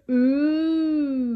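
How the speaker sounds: long, loud, high ghostly "ooh"; spooky ooh. Pretend. Host announcing spooky edition game, ghostly ooh. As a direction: wail a mock-spooky ooh.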